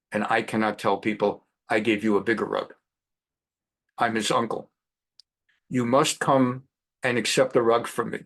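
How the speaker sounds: a hint of a Persian uncle's accent; heavy Persian accent. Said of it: "heavy Persian accent".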